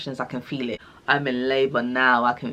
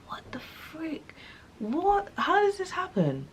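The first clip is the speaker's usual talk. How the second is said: much higher, breathy, slow disbelief; shocked whisper; 13 semitones higher, 8 dB quieter, slower than usual. Retold or imitated. Told the baby pooed inside, she gasps in disbelief. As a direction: gasp it out in hushed shock.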